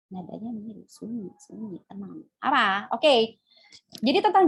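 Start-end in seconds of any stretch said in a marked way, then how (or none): 0.1-2.2 very soft, low-volume hush. Shown as a demonstration. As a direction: drop right down to a near murmur. Hushing listeners, echoing the quiet word.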